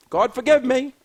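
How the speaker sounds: ordinary delivery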